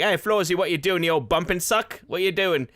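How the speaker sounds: lightly voiced Cockney, teasing the vacuum. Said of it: "he turns a little bit more cockney".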